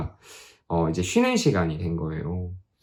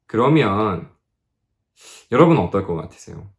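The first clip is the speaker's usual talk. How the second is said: ordinary delivery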